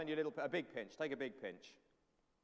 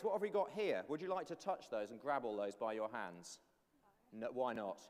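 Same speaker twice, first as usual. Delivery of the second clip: usual speaking voice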